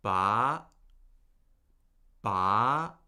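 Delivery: "Ba" gliding steadily upward; questioning upward lift on Ba; second tone. Shown as a demonstration. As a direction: start low and rise through each "Ba". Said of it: "you start steadily rising".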